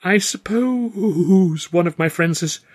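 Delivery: strained fluster. Acted. Arthur managing a question while being seduced. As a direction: squeeze the words out flustered. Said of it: "I managed to say".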